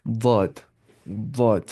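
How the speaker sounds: ज़ाद said alone, firm and clear; careful letter demo. Shown as a demonstration. Teacher showing the correct ज़ाद sound, careful demo. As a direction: pronounce ज़ाद precisely, not like दाद. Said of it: "perfection के साथ पढ़ना है".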